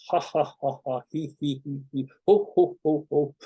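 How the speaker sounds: forced ha, he and ho syllables run through one by one; practice laughing. Shown as a demonstration. Teacher leads a laughing exercise, fake staged laughter. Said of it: "you know, you go".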